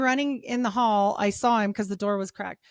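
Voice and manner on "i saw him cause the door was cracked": ordinary delivery, nothing marked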